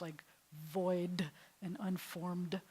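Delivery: slow, leaning on void and unformed; slow, hard consonants. Shown as a demonstration. Teacher stretching out the hard English letters. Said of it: "those are really hard letters".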